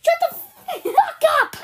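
explosive high-pitched shout; going hard. Acted. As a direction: yell it full force, cutting them off. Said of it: "you don't need to go that hard".

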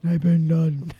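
mock-deep echo of someone's reply; imitating deep voice. Teasing the other speaker's answer, mock deep voice. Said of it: "you said that really deep".

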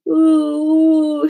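put-on high, loud childish wail. Melody bawling after her toe is stepped on, loud wail. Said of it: "she wailed".

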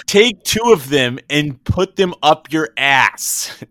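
loud, high, slow yelling; angry shout. Retold or imitated. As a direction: bark it out, furious. Acting out skit rudely.

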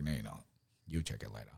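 a sly little devil whispering doubt; devil on the shoulder. Retold or imitated. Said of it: "The devil's like".

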